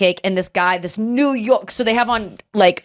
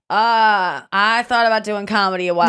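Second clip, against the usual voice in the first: mimicking a stranger. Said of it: "acted exchange".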